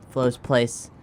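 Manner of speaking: normal talking voice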